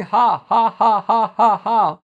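repeated mechanical "ha" syllables; deliberate, spoken fake laughing; forced laugh. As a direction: imitate someone making himself laugh. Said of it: "So the pastor kept saying".

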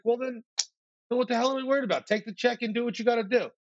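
high-pitched, exasperated go-ahead from a friend; impatient dismissal. Retold or imitated. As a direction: wave the worry away emphatically. Friend waves away worries about note.

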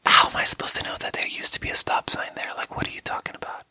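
exasperated whisper, no voice at all; whispered protest. Retold or imitated. Newcomer privately protesting the old man's scolding, exasperated whisper. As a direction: whisper it as an unspoken comeback. Muttered retort to the old man.

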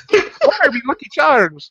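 high, slow silly leprechaun voice; mock leprechaun. Joking on the podcast that the toy boss is a leprechaun. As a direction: squeak it out like a cartoon leprechaun. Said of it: "I'm Saban".

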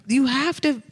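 high-pitched insistent retort; exasperated. Retold or imitated. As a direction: insist in a retold, worked-up protest. Retold defence to friends, continued.

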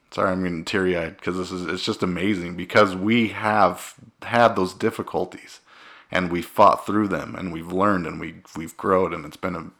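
ordinary talk, nothing marked

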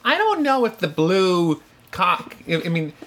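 lightly voiced Midwestern mother; a hint of a fussy Midwestern mom. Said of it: "I'm giving your mom a Midwestern voice".

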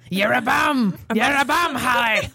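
mock trainer's heckle; Rocky trainer. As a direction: needle the trainee like a boxing-film coach. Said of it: "I feel like I'm in Rocky".

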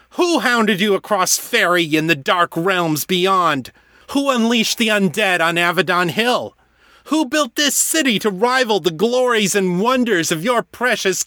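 furious lament to the heavens; raging cry. Acted. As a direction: rail at the ceiling. Raging at the dead rival.

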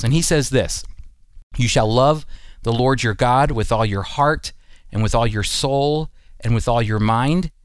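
normal talking voice

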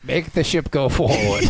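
low, slow starship order; mock captain. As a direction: command it with put-on gravity.

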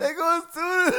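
high giggling words; laughing. Real, not acted. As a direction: laugh while saying it. Laughing at own joke.

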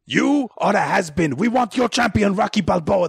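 high, put-on trash-talker voice; mock press-conference taunt. Rival camp calling out the boxer at press conference, mock-taunting.

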